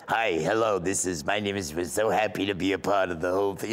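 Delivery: put-on comic old-lady sister voice; Rose. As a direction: chatter as a delighted old lady caller.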